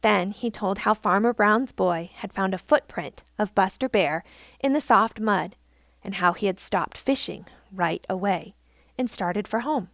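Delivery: ordinary delivery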